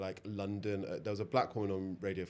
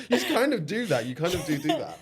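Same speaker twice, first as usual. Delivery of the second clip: high, loud, laughing voice; laughing agreement; 8 semitones higher, 10 dB louder than usual. Real, not acted. Interviewer agreeing co-host is nosy, laughing. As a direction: talk through real laughter.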